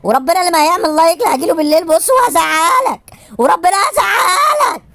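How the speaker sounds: rising into a slow drawn-out yelled threat; hammed-up threat to the viewers, building to a shout; mock threat. Comedian threatening viewers who won't like, ending in a slow mock yell.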